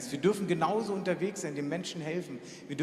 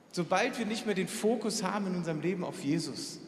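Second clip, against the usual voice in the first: normal talking voice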